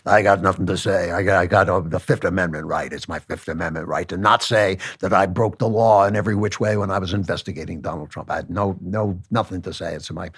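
low, mumbling tough-guy mobster; old-time gangster. Retold or imitated. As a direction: growl it out like a Mafia don. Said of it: "He sounds like a gangster".

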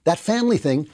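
high-pitched mock dismissal of critics' view; mocking imitation. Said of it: "now they're saying".

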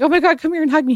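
high-pitched gushing plea; retold excited greeting. Woman re-enacting greeting him at the door, high excited mock plea.

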